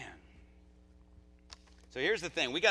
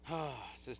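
ordinary talk, nothing marked

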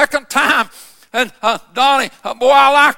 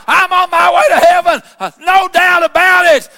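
joyful; 4 semitones higher, 3 dB louder than usual. Retold or imitated. Donnie rejoicing after being saved.

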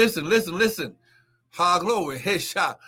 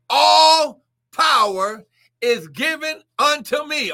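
slow, higher and louder, drawn out; booming Jesus quote; 8 semitones higher, 8 dB louder, slower than usual. Acted. Preacher to congregation, voicing Jesus in a slow booming proclaim. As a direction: proclaim it as Christ's own words.

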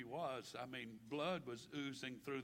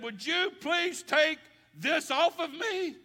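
much higher, louder and slow, pleading upward; desperate plea; 18 dB louder, slower than usual. Acted. Jesus asking God to take this away, desperate slow plea. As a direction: beg with everything you have.

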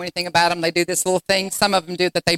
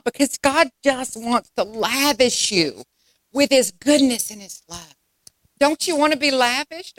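rising sermon call-and-response; fervent sermon delivery; preaching; 3 semitones higher than usual. Acted. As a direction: preach it to the congregation. Preaching to congregation.

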